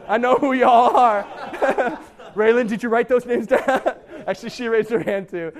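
loud, laughing teasing. Real, not acted. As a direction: tease the crowd through laughter. Laughing at the show of hands.

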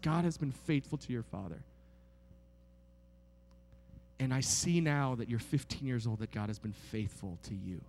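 soft, low, earnest father's voice; tender counsel. Retold or imitated. Father tells his fifteen-year-old son, soft and tender. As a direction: hold it close and gentle, like a blessing.

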